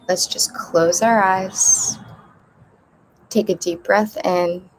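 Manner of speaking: measured meditation voice with long pauses; unhurried breathing instructions, spaced out; guided meditation. Real, not acted. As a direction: guide a group gently through breathing, leaving space between phrases. Leads breathing before guided meditation.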